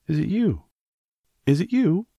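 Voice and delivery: high-pitched, eager calling out; excited cry. A narrator's reading. Mary greeting the robin on the wall, crying out excitedly. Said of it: "she cried out".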